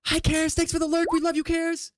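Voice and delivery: very high-pitched silly voice; high little fan-greeting voice; cutesy greeting. Pretend. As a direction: squeak out a sing-song thank-you.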